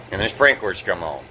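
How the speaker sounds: ordinary talk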